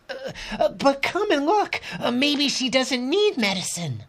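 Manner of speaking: put-on high, anxious girl pleading; young girl voice. Lena pleads.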